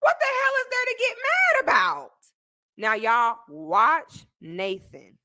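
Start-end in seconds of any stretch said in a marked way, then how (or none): 0.0-2.1 high-pitched, drawn-up disbelief; incredulous exasperation. Acted. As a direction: wail the question in hammed-up bafflement. Exasperated at others getting mad.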